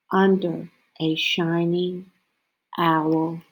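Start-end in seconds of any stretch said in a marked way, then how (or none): none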